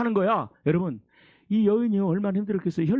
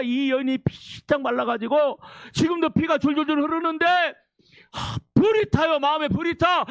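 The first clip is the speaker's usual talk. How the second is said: high, driving sermon delivery; impassioned preaching; 7 semitones higher than usual. Acted. Preacher urging congregation through the bleeding woman's story, impassioned preaching. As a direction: preach the story with mounting urgency.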